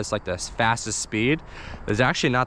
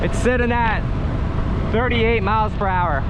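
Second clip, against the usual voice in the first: excited; 10 semitones higher than usual. Real, not acted. Fast scooter speed run.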